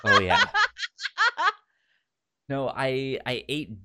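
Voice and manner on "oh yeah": laughing through the words. Real, not acted. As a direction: say it while laughing. Laughing at the joke.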